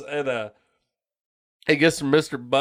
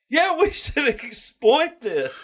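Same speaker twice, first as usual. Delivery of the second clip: mock greedy principal; 10 semitones higher, slower than usual.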